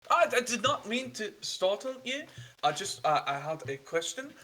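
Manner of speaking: put-on a polite young stranger, apologetic and a little breathless; nervous young traveler. GM voices traveler NPC.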